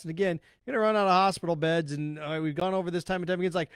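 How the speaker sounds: ordinary talk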